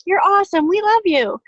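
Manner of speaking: imitating sugary fans, hammed up; mock gushing. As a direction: gush like a fan comment. Mimicking gushing commenters.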